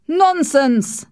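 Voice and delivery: loud, high outburst; angry shout. A narrator's reading. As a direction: dismiss it with an indignant shout. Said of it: "Sir Percival shouted".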